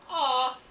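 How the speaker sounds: a loud, fond aww; cooing aw. Retold or imitated. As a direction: coo at the cute little pet. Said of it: "take pictures and go".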